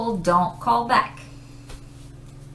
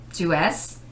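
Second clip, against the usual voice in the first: normal talking voice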